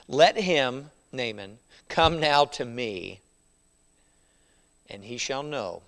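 scripture reading. Acted. Preacher reading the verse to his congregation, solemn. Reads the King James verse aloud.

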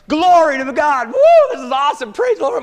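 loud, high shouts of joy; joyful praise. Retold or imitated. Fishermen hauling in the catch, shouting praise loudly. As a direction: whoop it up like thrilled fishermen.

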